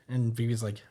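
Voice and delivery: ordinary talk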